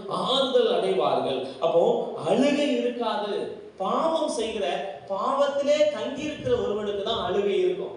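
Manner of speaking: loud, high-pitched sermon delivery; forceful preaching. Real, not acted. Preaches with raised voice.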